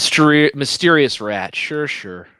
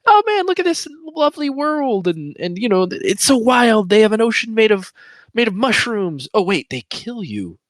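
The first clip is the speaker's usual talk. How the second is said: mock wonder; 7 semitones higher than usual. Mock naive wonder at world.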